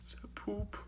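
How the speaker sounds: breathless whisper at the cabinet. Real, not acted. Vlogger peeking into the cabinet at a hidden animal, whispering. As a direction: hush it so the creature won't hear.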